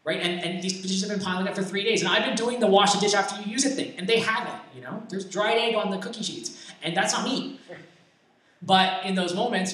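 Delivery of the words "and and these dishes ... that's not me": high-pitched, acted-out complaint; indignant protest. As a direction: plead your case as the wronged roommate. Acted complaint about unfair dish duty.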